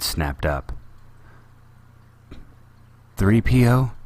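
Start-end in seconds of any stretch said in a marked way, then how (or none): 3.2-3.9 trembling with anger. A narrator's reading. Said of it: "her voice trembling with anger".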